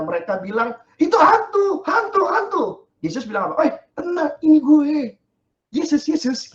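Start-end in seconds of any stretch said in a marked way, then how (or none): 1.0-2.6 much higher, louder hammed-up shrieks; hammed-up shrieks at the ghost; panicked ghost cries. Disciples' panic retold. 3.7-6.5 much higher, loud chummy reassurance; laid-back it's-me call; Jesus calming scared friends, casual. Pretend.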